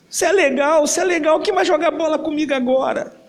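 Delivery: put-on high childlike pleading voice; a child asking who he'll play with now; grieving little brother. Younger brother speaks to his dead brother, high and pleading.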